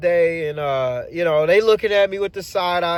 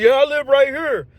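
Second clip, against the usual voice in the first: a lightly higher coworker voice; casual higher-pitched buddy; coworker's higher voice; 7 semitones higher, 4 dB louder than usual. Retold or imitated. Coworker pointing out his home, higher voice.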